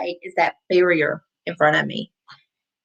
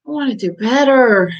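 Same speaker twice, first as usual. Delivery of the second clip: a firmer, louder take of the line; tone demo; 4 dB louder than usual. Shown as a demonstration. Coach showing self-talk tone, a firmer louder take. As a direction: say it like you mean it, a bit louder. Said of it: "do you say it to yourself as".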